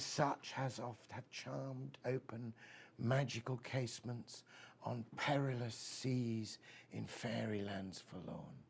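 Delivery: soft, slow, hushed poem reading; hushed recital. Acted. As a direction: let it fade to a near whisper. Recites Keats ode.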